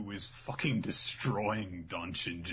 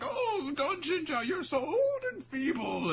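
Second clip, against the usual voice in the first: put-on high, slow quavering old man; hammed-up high weepy lament; wailing old man; 5 dB louder, slower than usual. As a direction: whine it out like a frail elder.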